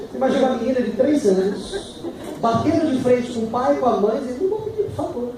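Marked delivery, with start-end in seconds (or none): 4.4-5.3 lightly voiced defiant little girl, higher; mock toddler defiance. Father imitating his three-year-old refusing to say please, high and pouty.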